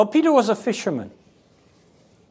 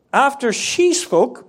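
usual speaking voice

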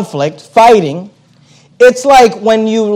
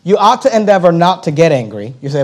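normal talking voice

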